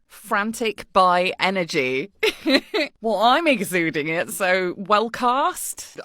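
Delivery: normal talking voice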